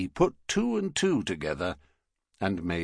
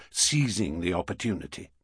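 ordinary delivery, nothing marked

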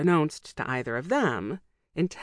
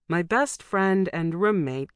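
usual speaking voice

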